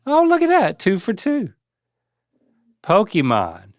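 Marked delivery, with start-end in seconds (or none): 0.1-1.5 high, loud impressed exclaim; impressed surprise. Real, not acted. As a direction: sound genuinely impressed. Impressed by correct answer.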